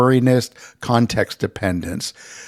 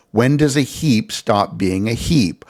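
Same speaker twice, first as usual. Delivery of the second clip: usual speaking voice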